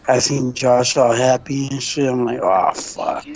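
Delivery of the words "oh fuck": retold dismay. Retold reaction to bad news.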